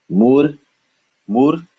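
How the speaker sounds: "Mur" held alone on a rising pitch; syllable demo. Shown as a demonstration. Teacher breaking up the word, "Mur" sounded out alone. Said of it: "the second syllable is te".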